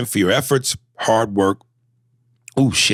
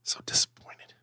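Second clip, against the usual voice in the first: whispered, softer mock disappointment; disappointed whisper; 11 dB quieter than usual. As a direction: breathe it out like a let-down sigh. Mock lament after losing argument.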